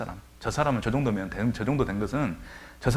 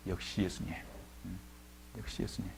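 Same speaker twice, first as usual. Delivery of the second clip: awed murmur; 3 semitones lower, 13 dB quieter than usual. Retold or imitated. Crowd amazed at the miracle.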